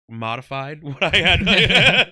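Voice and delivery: slow words broken by a chuckle; laughing. Real, not acted. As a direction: laugh through it, drawn out. Admits modifying, both laugh.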